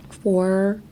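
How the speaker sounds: "Forward" in two slow parts, throat open. Shown as a demonstration. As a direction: say "Forward" slowly, chin pushed forward. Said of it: "open your throat like that".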